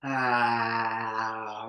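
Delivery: long, loud open ah; relaxed-jaw ah. Shown as a demonstration. Leader showing the group a relaxed jaw, long open ah. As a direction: release a drawn-out ah with a loose jaw. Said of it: "relax that jaw".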